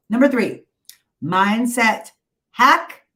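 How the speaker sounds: announcing loudly. Real, not acted. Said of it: "announces the hack loudly".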